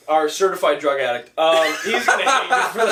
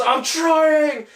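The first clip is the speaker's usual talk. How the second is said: high, panicked mock yell; hysterical Leo impression; 10 semitones higher, 3 dB louder than usual. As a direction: imitate frantic overacting under pressure.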